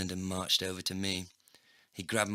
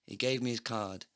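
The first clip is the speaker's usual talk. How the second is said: plain reading voice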